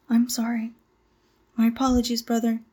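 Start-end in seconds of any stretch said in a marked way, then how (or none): none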